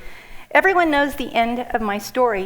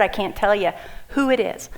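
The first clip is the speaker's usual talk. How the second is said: ordinary delivery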